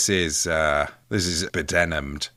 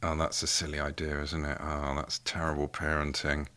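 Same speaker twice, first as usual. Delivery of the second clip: mock disapproving; 4 semitones lower, 11 dB quieter than usual. Mock scolding of self, quiet.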